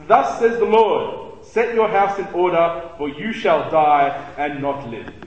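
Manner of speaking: slow, loud, weighty proclamation; solemn scripture reading. Shown as a demonstration. Youth leader reads Isaiah's message to the king, slow and grave. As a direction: read it like a prophet's verdict, very slowly. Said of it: "Isaiah comes to him and says".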